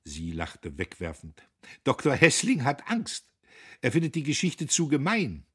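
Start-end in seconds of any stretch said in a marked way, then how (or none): 1.8-5.4 put-on higher, fast, brittle chatter of a feverish young woman; Guste, feverish young woman. A narrator's reading. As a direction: rattle it off as Guste, nervy and edgy. Guste voiced by narrator. (Guste, höher und schnell, fiebrig plappernd)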